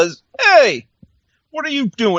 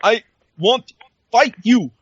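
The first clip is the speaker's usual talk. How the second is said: broken English promo; 4 semitones higher than usual. Retold or imitated. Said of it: "he says it broken English".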